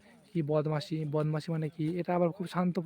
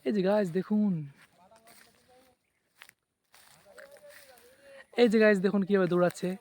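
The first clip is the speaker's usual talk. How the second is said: excited calling out; 3 semitones higher, 6 dB louder than usual. Real, not acted. Calling out at the running fox.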